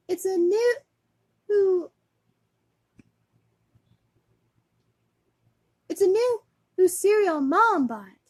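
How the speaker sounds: high, loud put-on kid voice; acted commercial kid. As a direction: announce it like a boy in an ad. Acting out the boys' cereal commercial.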